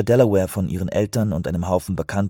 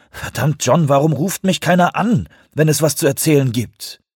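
higher, louder exasperated complaint; grumpy outburst; 8 semitones higher, 5 dB louder than usual. A narrator's reading. Sick man snapping at a colleague on the phone, exasperated. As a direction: demand an explanation, annoyed and raised. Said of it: "beendete mürrisch das Gespräch".

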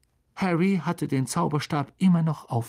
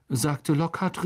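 plain reading voice

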